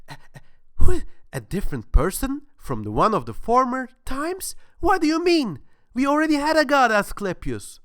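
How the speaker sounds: high, loud, puzzled voice; a naive worshipper asking his god; gullible questioner. Acted. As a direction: press the god like a confused believer. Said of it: "Gullible Man says".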